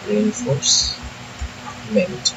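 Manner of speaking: drawn-out dictation. Shown as a demonstration. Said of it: "এটা নাম দিব".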